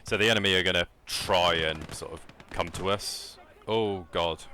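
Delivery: startled as the enemy charges; alarmed. Real, not acted.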